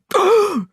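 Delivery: loud high gasp of horror; shocked gasp. A narrator's reading. Headmistress gasping in horror. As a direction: gasp as if seeing a ghost. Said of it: "the gasp that came from the Trunchbull's throat".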